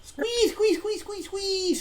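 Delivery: very high, squeaky rat squeals; squeaking rat voice. Acted. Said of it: "says the rat".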